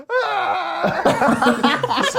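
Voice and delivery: high mock scream with laughter; imitated burning scream. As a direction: scream as if burned, hammed up. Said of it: "he gets burned alive".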